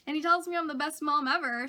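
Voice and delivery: usual speaking voice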